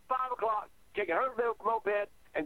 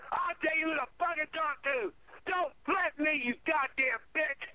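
furious tirade; 5 semitones higher than usual. Retold or imitated. Reliving a row with the boys' grandmother, furious. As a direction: hurl each demand like a challenge.

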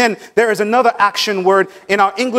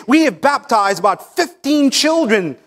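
a hint of a boastful pastor, higher at the end; mock proud boast; 5 semitones higher than usual. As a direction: lightly ham up a self-satisfied church report. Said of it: "and say".

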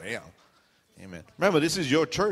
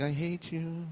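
softer, under-the-voice grudge; secret inner thought; 7 dB quieter, slower than usual. Acted. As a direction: quietly let the hidden thought out. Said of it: "In your head, you're like".

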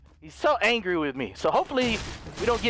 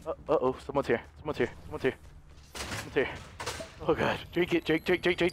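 hushed; 7 semitones lower, 7 dB quieter than usual. Real, not acted. Enemy arrives, he hushes.

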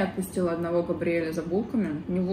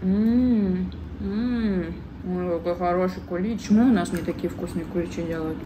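speaking between bites; talking while chewing. Real, not acted. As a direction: mouth full of pastry. Seen 3: Bites panettone at 1.2s, visibly chewing while speaking through 5.4s.

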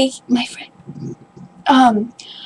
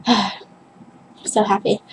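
usual speaking voice

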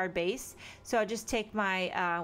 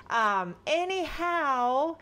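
slow mock reset; 5 semitones higher, 4 dB louder, much slower than usual. Real, not acted.

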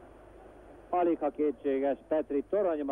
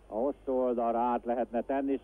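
ordinary talk

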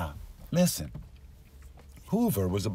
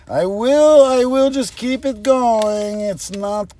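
loud, high burst of determination; defiant rally; 10 semitones higher, 8 dB louder than usual. Acted. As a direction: cry out a vow to push on. Rallying themselves after admitting tiredness.

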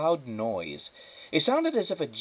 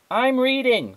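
much higher, loud reply; shouting back; 7 dB louder than usual. A narrator's reading. Flora shouting back to her mother, loud. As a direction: yell back down, annoyed. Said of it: "Flora shouted back".

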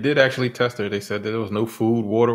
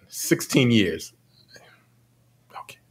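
slow breathy whisper of the reply; whispered mock answer; 3 semitones lower, slower than usual. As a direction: whisper it like a confession.

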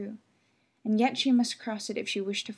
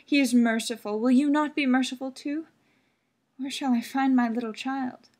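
louder, higher earnest begging; pleading mother; 4 semitones higher, 7 dB louder than usual. Acted. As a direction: plead for mercy. Mother begging old woman.

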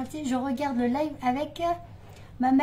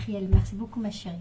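ordinary talk

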